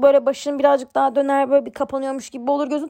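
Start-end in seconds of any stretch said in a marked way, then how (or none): none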